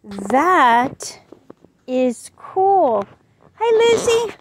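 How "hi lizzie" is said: higher, chirpy greeting; a talking book says hello. Acted. Talking book greets little Lizzie in a high voice.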